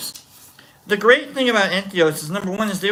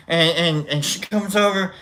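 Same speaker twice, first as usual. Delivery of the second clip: usual speaking voice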